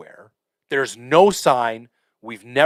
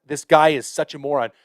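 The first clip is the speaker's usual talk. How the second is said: ordinary talk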